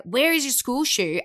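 loud, high frazzled parent yelling; stressed snap. Retold or imitated. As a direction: bark it at the kid while running late. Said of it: "you're more likely to be like".

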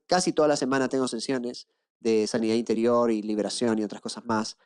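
ordinary talk, nothing marked

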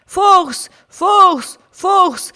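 high, loud crowd chant; Anfeuerungsrufe. A narrator's reading. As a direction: cheer like fans backing their champion. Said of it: "riefen die einen". (laute, hohe Sprechchor-Rufe)

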